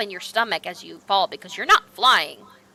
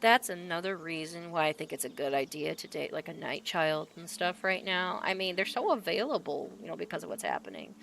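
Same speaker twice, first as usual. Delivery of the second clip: lower, softer gossip; overheard reaver chatter; 5 semitones lower, 10 dB quieter than usual. Acted. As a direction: chat casually, unaware. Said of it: "you just hear".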